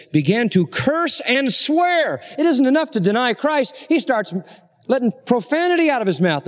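high, intense pulpit delivery; fervent preaching. Acted. Preacher stirring his congregation at full force. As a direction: preach it out with rising fervor.

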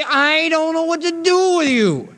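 high, slow, drawn-out defeat; exasperated giving up. Retold or imitated. Worn-out friend to someone who won't listen, exasperated. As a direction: throw up your hands and let it out slowly. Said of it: "finally you just say".

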